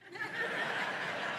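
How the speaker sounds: short high unvoiced giggle; amused laugh at the ten-year-olds' question; high breathy laugh. Real, not acted.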